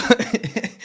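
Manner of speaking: laughing high. Real, not acted. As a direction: burst out laughing. Laugh after imitation.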